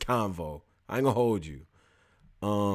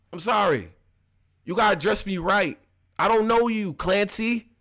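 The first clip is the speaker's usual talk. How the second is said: indignant; 8 semitones higher, 4 dB louder than usual. Retold or imitated. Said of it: "gotta talk to us a certain way".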